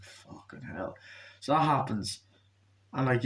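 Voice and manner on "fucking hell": breathy, near-silent swearing; unimpressed whisper to yourself; whispered curse. Retold or imitated. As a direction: say it under your breath, fed up. His own muttered reaction.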